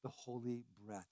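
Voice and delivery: a gentle blessing, softly breathed; soft breathy quote. Retold or imitated. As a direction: hush it out as Jesus. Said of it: "breathed on the disciples and said".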